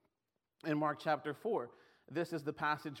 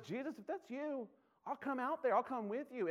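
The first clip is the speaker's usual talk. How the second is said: eager Peter; 8 semitones higher than usual. Acted. Peter voiced eagerly, higher pitch.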